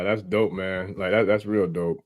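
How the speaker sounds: ordinary talk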